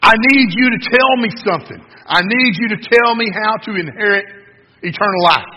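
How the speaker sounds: loud, high, over-eager plea; hyped-up excitement. Acted. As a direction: demand it breathlessly keen, hammed-up shout. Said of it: "he's all excited and he's all hyped up".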